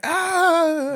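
high yelp as he falls; sudden hammed-up yelp; mock cry of pain. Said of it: "He's like".

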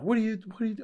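high, mock-flustered protest; imitating mad wife. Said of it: "she got really mad about it".

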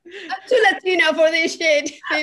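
loud, high laughing outburst; laughing exclaim. Real, not acted. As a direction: shout it through a laugh, high. Cohost joking exclamation.

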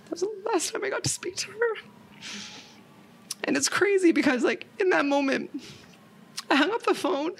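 high, tearful voice; real crying. Real, not acted. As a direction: choke the words out through tears, with long pauses. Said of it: "I'm crying with you".

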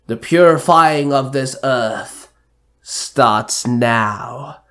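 slow, measured villain proclamation; lightly voiced villain. A narrator's reading. Villain declares the earth's purifying, slow and mildly menacing. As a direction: draw out the declaration with mild menace. Villain announces his attack.

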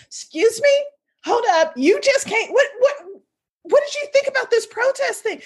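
high, disbelieving hold up; incredulous mom. Retold or imitated. Mom to daughter, stunned disbelief. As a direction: mom can't believe what daughter did. Mom's incredulous reaction retold.

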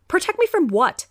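insistent disbelief with a falling finish; demanding echo. Acted. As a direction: press him for an answer. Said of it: "I echoed".

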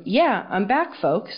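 usual speaking voice

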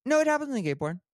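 words tumbling out in a rush; rushed mumble. Real, not acted. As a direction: let the words fall out carelessly, run together. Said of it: "you said that so fast".

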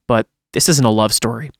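normal talking voice

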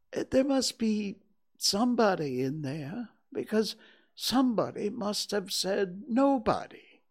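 high-pitched pondering bear; Pooh, puzzled. Acted. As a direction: reason it out to yourself, pitched high. Pooh thinks to himself.

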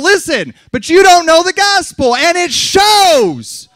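high, loud accusation, last word drawn out; shouted scolding. Real, not acted. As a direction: yell the reproach and stretch the final word. Scolding the congregation, then apologising.

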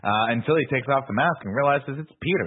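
ordinary delivery

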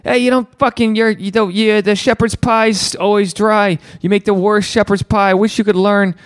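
high, hammed-up bullying rant; mock rant at a wife about shepherd's pie; mock yelling husband. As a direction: caricature a husband barking at his wife. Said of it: "a guy can just yell at his wife".

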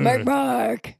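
drawn-out zombie call; arguing zombie. Acted. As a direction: moan the name out like an undead arguer. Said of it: "these two zombies staring at each other".

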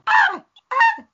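honking high shriek, twice; two loud high squawks; goose in pain. Retold or imitated. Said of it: "you sound like a geese in pain".

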